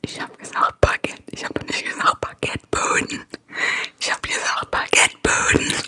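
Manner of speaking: a whispered attempt at a Saxon accent in German. Streamer quoting a German meme to chat in a whispered Saxon accent. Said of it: "from someone talking Sächsisch".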